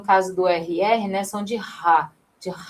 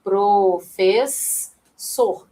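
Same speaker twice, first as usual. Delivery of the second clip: Professor drawn out very slowly, syllable by syllable; slow syllable split; much slower than usual. Shown as a demonstration. Teacher spelling out syllables to her live class, very slow. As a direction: stretch out pro-fes-sor so each syllable is heard. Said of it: "tá vendo?".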